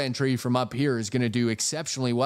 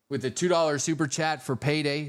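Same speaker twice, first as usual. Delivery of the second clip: ordinary talk, nothing marked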